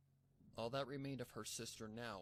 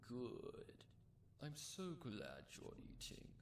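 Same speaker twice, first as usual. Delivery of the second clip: higher, slow, sinister sweetness; creepy gloating villain; 5 semitones higher, slower than usual. Acted. Cult captor gloating over the filly eating her sister. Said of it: "The cult laughed".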